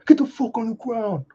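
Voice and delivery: high-pitched mock raid command; acted, strained shouting at captives; soldier's yelled order. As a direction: yell it like a soldier storming in. Acts soldier raiding a house.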